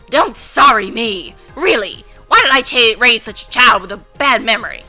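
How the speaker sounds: loud, angry scolding grandma; rowdy grandma. Acted. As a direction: blow up at the forgetful grandson. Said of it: "rowdy grandma voices".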